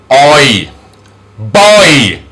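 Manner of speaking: put-on stretched oi, then Boy; long sound demonstration. Shown as a demonstration. Teacher models long sounds, oi and Boy stretched. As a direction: draw out oi inside Boy. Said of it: "the long and short sounds".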